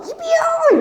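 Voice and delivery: very high, drawn-out mimicked class chorus; kids in unison. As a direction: squeal it like a whole class answering. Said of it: "이러더라고". (단체로 외치는 아이들 흉내, 아주 높게)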